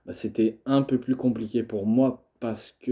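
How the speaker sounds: usual speaking voice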